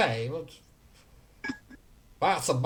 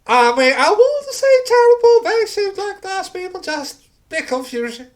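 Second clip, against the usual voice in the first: high-pitched NPC voice; 9 dB louder than usual. Acted.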